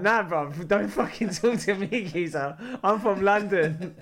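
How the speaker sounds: loud, drawn-out comic protest; hammed-up London indignation; mock indignant brush-off. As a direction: warn him off loudly, playing it for laughs. Retold brush-off of the stranger.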